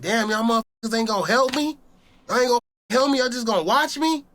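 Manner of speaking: high, disbelieving outcry; desperate plea. Retold or imitated. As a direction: shout your disbelief at them. Pleading with the watching police.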